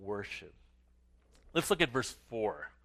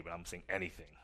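usual speaking voice

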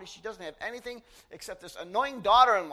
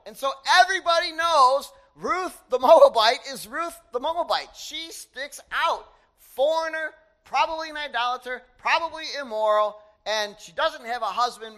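loud preaching emphasis; 5 semitones higher, 10 dB louder than usual. Real, not acted.

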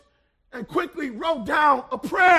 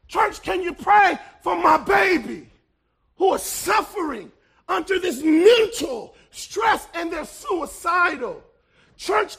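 fiery Sunday sermon, swelling and falling; preaching; 3 semitones higher, 4 dB louder than usual. Acted. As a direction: preach it at full force to the church. Preaching to the congregation.

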